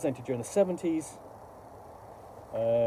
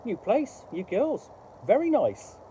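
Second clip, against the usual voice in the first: high parrot voice, a bit louder at the end; 9 semitones higher, 3 dB louder, slower than usual. Acted. Parrot greeting the daughters, high cheeky parrot voice.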